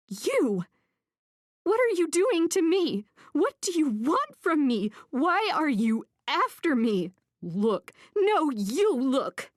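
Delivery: high, loud, raging questions; furious shout. A narrator's reading. Armed woman to the man stalking her, furious shouting. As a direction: let the fury spill over. Cornered woman rages at him.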